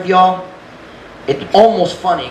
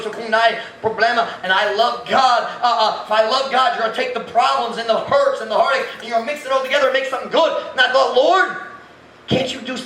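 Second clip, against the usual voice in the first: high, pleading whine that climbs higher; 8 semitones higher than usual. Retold or imitated. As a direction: beg God like a tired child, climbing up. Retold pleading prayer, pitch rising.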